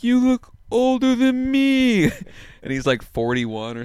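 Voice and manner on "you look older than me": high, loud, slow drawn-out voice; Michael Lehrer impression. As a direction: stretch each word out high and loud. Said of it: "upset by the voice I'm doing".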